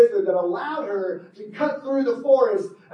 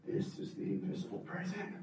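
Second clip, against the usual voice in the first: dread whisper; 13 semitones lower, 17 dB quieter than usual. A narrator's reading. Dread realisation.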